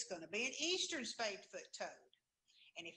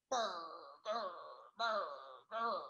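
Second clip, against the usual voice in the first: burr like a big, tough toad; big tough frog; 4 semitones lower, slower than usual. Shown as a demonstration. Teacher imitating the eastern spadefoot toad, big tough frog croaks. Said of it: "sounds like a big, tough frog".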